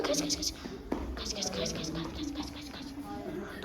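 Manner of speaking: hushed whisper, fading away to nothing. Pretend. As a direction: whisper it, growing ever fainter. Pretend fleeing from thief quietly.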